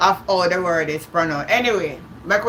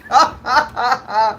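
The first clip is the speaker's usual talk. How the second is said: real, higher-pitched burst of laughter; hearty laugh; 4 semitones higher than usual. Real, not acted. Amused at a chat comment.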